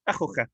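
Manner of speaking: Akhuka fast, khu cut short; short-vowel form. Shown as a demonstration. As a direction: say Akhuka quickly with a brief u. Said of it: "five times fast".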